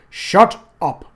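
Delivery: loud sharp order; curt command. Acted. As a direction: shut him down hard.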